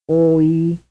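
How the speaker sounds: two separate beats; two-vowel sequence. Shown as a demonstration. Teacher quizzing students. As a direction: keep the vowels apart. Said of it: "The answer is A".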